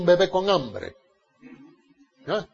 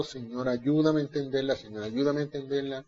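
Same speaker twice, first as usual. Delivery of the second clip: soft pleading prayer; 5 semitones lower, 9 dB quieter than usual. Pretend. Voiced prayer of a reader.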